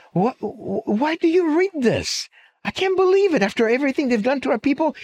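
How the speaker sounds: high, incredulous protest; can't believe what he's reading; stunned disbelief. Retold or imitated. Old man on a bench in Brooklyn, aghast at his friend's newspaper, high and incredulous.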